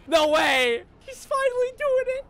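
very high, near-crying excitement; overwhelmed squealing. Real, not acted. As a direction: squeal it in disbelief. Overwhelmed by the hype.

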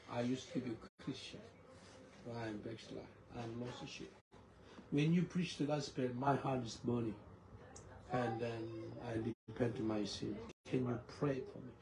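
low, soft, subdued confession; hushed and heavy, lightly acted; retold tearful confession. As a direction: imitate a broken man confessing quietly. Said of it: "He was crying".

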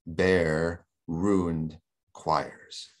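"Bare ruined choirs" very slow, each word stressed apart; three heavy stresses. Shown as a demonstration. Lecturer demonstrating three stressed syllables, very slow and halting. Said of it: "we distress three of those syllables".

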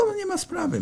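high, soft put-on breezy reply; casual no problem. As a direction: toss it off lightly, mock-casual. Retold easygoing reply to prosecutor.

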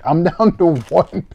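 normal talking voice

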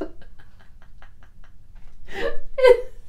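real breathless high laugh; high laughing fit. Real, not acted. Laughing hard about the puppy deposits. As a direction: lose it laughing.

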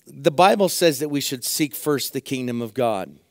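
ordinary talk, nothing marked

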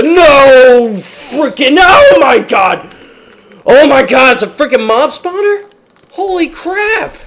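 high, loud, astonished outburst; shocked shouting. Real, not acted. Gamer stumbling on a mob spawner, shocked shouting. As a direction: cry out in disbelief, pitched high throughout.

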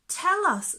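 high, loud coaxing; eager. A narrator's reading. Booby urging the fish to tell, high and eager. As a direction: beg to be told. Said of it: "said the bone-eating snot flower worm".

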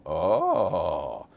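long drawn-out "oh" of realization; knowing "oh". Acted. Teacher reaching the point about Aaron, knowing. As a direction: let the penny drop in one low, stretched "oh". Acts dawning realization after explanation.

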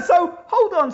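bursts out laughing, then hold on; delighted laugh at the coincidence; laughing in surprise. Real, not acted. Learning they shared a school, laughing in surprise. Discovers same primary school.

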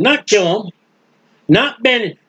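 usual speaking voice

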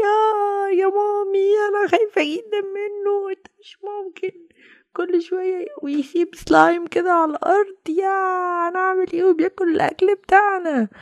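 put-on high child voice, frightened and pleading; a small kid calling to mommy in fear; frightened child begging mommy for help; scared little child.

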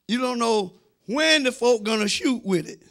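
ordinary talk

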